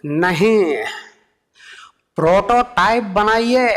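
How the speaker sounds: Modi impression. Modi rejecting the idea with a slow decree. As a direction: order it like a leader's decree.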